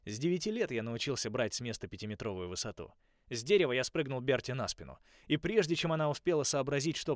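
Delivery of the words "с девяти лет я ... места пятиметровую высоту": plain reading voice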